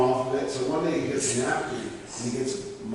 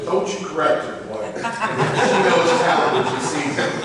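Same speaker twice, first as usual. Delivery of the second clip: a hint of a sassy old mother, louder; mock-stern elderly mom, slow at the end; scolding mom; 5 semitones higher, 7 dB louder than usual. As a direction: playfully scold the son. Mom scolding son in story.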